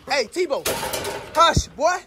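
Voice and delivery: telling dog to hush. Real, not acted. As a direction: shut the barking down. Hushing barking dog.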